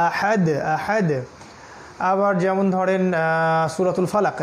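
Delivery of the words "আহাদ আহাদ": আহাদ said slowly with the "d" rebounding; qalqala echo. Shown as a demonstration. Teacher models the verse ending, echoed final "d" in আহাদ. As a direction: bounce the end of each আহাদ. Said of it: "কলকলা করে".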